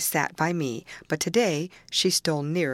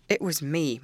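ordinary delivery

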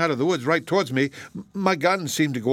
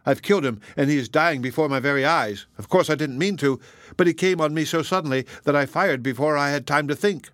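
ordinary delivery

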